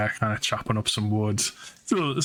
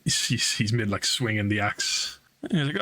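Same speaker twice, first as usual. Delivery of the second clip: ordinary talk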